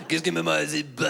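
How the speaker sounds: mock French gibberish; made-up French; French noises. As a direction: babble nonsense as if it were French. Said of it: "I'd just make French noises".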